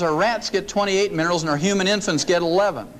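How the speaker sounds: normal talking voice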